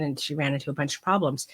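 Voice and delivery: ordinary talk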